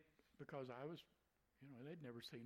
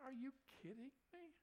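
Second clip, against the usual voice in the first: much higher, incredulous are-you-kidding-me; disbelief; 10 semitones higher than usual. Real, not acted. Preacher hearing the woman shame the man, incredulous. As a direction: react in stunned disbelief.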